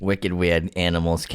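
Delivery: put-on light Boston accent, wicked weird; a hint of Boston on wicked. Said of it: "throwing a little Boston accent".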